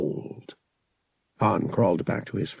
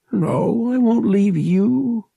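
grieving promise; 13 semitones higher, 6 dB louder, slower than usual. Acted. Han holds dying friend.

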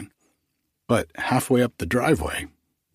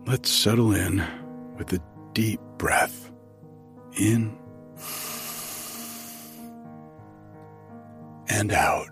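sleep meditation; 6 dB quieter than usual. Acted. Leads a breathing meditation.

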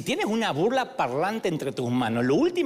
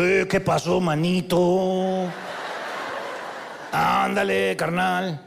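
put-on Mexican accent; much slower than usual. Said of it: "Le perdoné la vida a los mexicanos".

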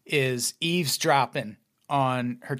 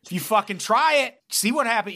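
louder, higher menacing scold; mock threatening parent; 7 semitones higher, 3 dB louder than usual. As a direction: threaten the kid with mock fury. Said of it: "be like".

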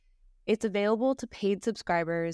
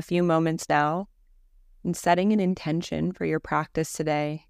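calm, even Yoga Nidra guidance with long pauses; guided meditation; 3 semitones lower, 5 dB louder than usual. Acted. Teacher guiding listeners through Yoga Nidra practice, calm guided-meditation voice. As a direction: lead them gently into rest, leaving space after each phrase.

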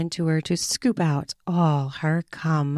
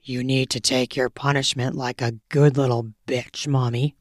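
dominant lover; 6 semitones lower than usual. A narrator's reading. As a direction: growl the order low and close. Male lover voiced low.

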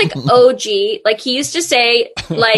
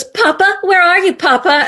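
whiny call for Papa; 8 semitones higher than usual. Retold or imitated. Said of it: "she goes".

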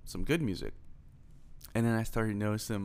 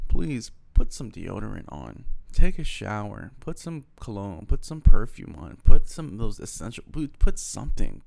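much louder, pleading with the crowd; loud plea; 10 dB louder than usual. Real, not acted. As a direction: yell the advice at them. Calling out to listeners, pleading.

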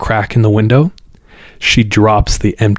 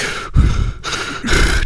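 heavy breathy heaving, no voice; imitated heaving breaths; 3 dB quieter than usual. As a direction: act out someone heaving for breath. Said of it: "just heaving in the rain".

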